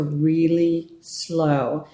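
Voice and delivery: slow, stretched emphasis on each word; slow and deliberate; drawn out. Real, not acted. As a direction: stretch the words out slowly. Drawn out to match meaning.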